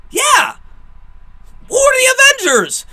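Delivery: very high, loud squawk of disbelief; incredulous mock shout. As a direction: blurt it out in exaggerated bafflement. Acting out the Avengers exchange.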